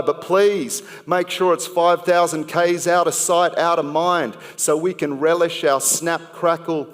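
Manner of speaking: rhythmic slam poetry delivery with pointed pauses; poem recited to a live crowd with rising punch; spoken word poem. Acted. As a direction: perform it like a slam poet building to a sting.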